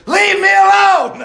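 loud, high shout of leave me alone; angry shout. Retold or imitated. Niece shouting at the preacher to leave her be, loud angry yell. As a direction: yell it at him to back off.

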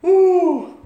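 high loud woo; preacher whooping; excited whoop. Real, not acted. Preacher's exclamation.

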